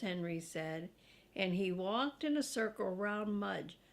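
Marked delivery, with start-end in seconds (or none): none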